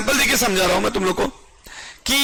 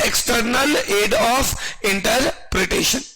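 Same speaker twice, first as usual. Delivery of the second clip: very slow drawn-out term; much slower than usual. Real, not acted. Teacher drawing out key term.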